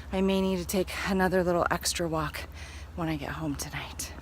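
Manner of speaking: out of breath walking uphill. Real, not acted. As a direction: talk while out of breath on a climb. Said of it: "I'm a little out of breath 'cause I'm going uphill".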